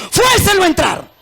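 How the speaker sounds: "Fuércenlo a entrar" gritado y agudo; grito de predicador. Retold or imitated. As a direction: proclama el mandato con fuerza. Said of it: "Jesús dijo". (grito de predicador citando a Jesús)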